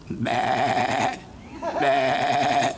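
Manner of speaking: low, drawn-out mock bleating; imitating a sheep.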